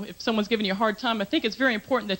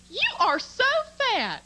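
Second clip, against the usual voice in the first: sweet Southern belle; 13 semitones higher than usual. Retold or imitated. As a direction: insult a stranger sweetly, with a Southern accent. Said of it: "because of the way they sound".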